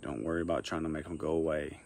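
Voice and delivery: soft guided meditation with long pauses; quiet, unhurried meditation guidance. Acted. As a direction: lead them gently, leaving long silences between phrases.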